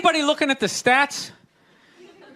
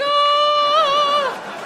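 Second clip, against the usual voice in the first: high sustained heavenly choir ah; angelic ah; 12 semitones higher than usual. Pretend. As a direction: sing a high angelic ah as he descends. Angelic descent sound.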